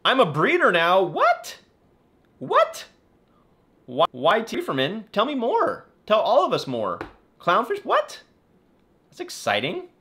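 high-pitched, loud amazement; excited surprise. Real, not acted. Reacting to a viewer's clownfish breeding news, excited surprise. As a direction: squeal each "What?" very high.

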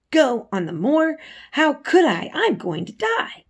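high, alarmed disbelief, rising in pitch; a frightened boy recoiling from the idea; startled protest. A narrator's reading.

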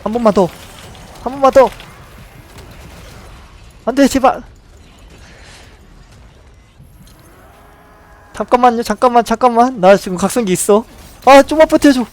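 high, loud frantic pleading; panicking in a close fight; excited. Real, not acted. Tense fight, begging teammate.

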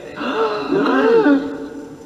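high laughing gasp; dramatic gasp. Acted. Mock villain reveal.

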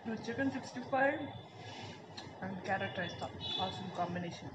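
talking while chewing; words muffled by food; mouth full. Real, not acted. Seen 0: Cheeks bulge with food while talking, after taking a bite.

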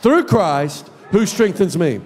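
slow, shouted proclamation; full-force sermon shout; preaching declaration. Acted. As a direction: preach it like a battle cry.

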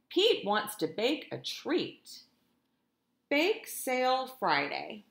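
lively, sing-song storytime reading; animated picture-book narration; reading to children. A narrator's reading. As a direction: read it like a teacher to her class. Teacher reads picture book aloud.

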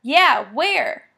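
loud, high, puppyish eagerness; eager yip. Acted. Dusty yipping at Jack about where she'll head, eager and loud. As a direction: jump on it like an excited puppy. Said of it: "Dusty yips like a puppy".

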